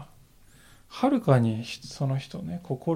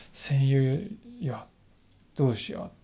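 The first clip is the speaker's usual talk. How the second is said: tender; 6 dB quieter, much slower than usual. Acted. Jesus calling Judas friend.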